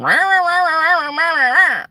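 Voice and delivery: high, loud mock blabber of a Texan on the phone; loud Texas guy on phone. Mocking the loud roommate down the hall in a high, loud Texas blabber. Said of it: "with his Texas accent".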